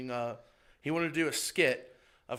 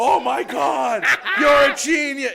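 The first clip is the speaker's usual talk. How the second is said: loud, high crowd rave; hammed-up ecstatic praise; mock amazed shout; 10 semitones higher, 12 dB louder, slower than usual. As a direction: shout it like a blown-away fan, stretched out. Crowd busting out laughing, praising him.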